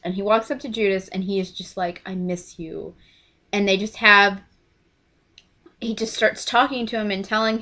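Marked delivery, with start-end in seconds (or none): 2.1-2.9 soft, gentle confession; tender. Retold or imitated. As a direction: say it softly, to an old friend. Jesus approaches Judas.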